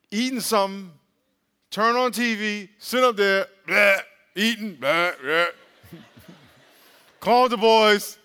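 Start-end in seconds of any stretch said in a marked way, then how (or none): 3.7-5.5 sloppy mock couch sounds; lazy slurring noises. Imitating couch lazing. 7.2-8.2 slow, loud, laughing shout-out; laughing loud call. Retold or imitated. Mock lazy call out.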